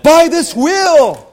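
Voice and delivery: ordinary delivery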